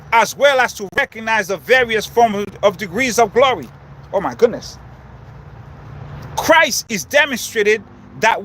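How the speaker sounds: preaching. Real, not acted. Preaching outdoors on spiritual laws.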